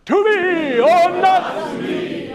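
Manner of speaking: very high, very loud, slow booming to the balcony; old-style grand declaiming. Shown as a demonstration. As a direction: boom it to the third balcony. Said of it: "throw your head back".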